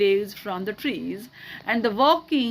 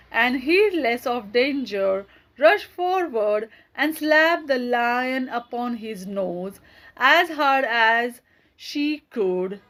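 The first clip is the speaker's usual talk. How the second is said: plain reading voice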